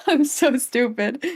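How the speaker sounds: laughing, slow and wheezy. Real, not acted. Laughing at herself.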